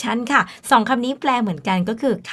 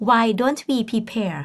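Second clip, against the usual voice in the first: very slow, careful classroom read; slow example read for Thai learners; slow model sentence; much slower than usual. Shown as a demonstration. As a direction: draw each word out for the class. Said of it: "teacher models the example slowly".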